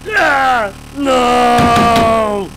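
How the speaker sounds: loud, high wails at a blue screen; screaming in despair. Real, not acted. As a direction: scream as the computer crashes.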